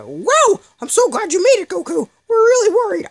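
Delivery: very high, loud cartoon sidekick voice; Krillin. Sidekick greeting the hero who arrived to save him, very high and loud. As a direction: squeal it out like a jittery little buddy. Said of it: "it's a terrible Team four Star Krillin impression".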